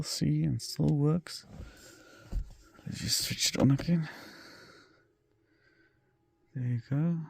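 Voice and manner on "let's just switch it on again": ordinary talk, nothing marked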